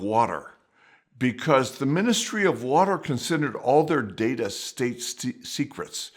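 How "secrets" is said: ordinary delivery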